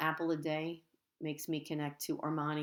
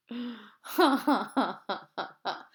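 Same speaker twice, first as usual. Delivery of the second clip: loud high laugh; 6 semitones higher, 6 dB louder than usual. A narrator's reading. Class laughing at Andrea, loud high laughter. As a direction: burst out laughing. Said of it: "we all laughed".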